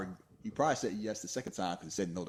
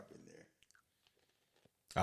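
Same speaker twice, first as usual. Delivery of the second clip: slow whisper; 8 dB quieter, much slower than usual. Acted.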